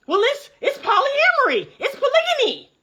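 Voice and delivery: high, sing-song mock of their excuse; mock defence of polyamory. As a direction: parody their fancy names.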